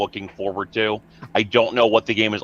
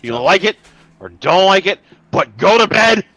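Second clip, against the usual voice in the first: wrestling promo shout; 10 semitones higher, 7 dB louder than usual. Acted.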